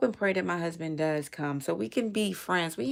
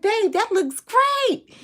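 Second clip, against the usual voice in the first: very high, slow, sing-song praise; sweet wife praise; 9 dB louder, slower than usual. Retold or imitated. Wife praising her husband's mowed grass, cooing high and slow.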